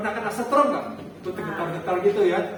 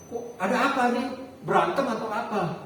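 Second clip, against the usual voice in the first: ordinary talk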